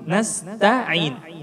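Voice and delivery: "Nasta'in" softly, with light fronted "ta". Shown as a demonstration. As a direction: keep the tongue down in Nasta'in. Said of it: "posisi lidah turun". (Nasta'in tipis, lidah turun)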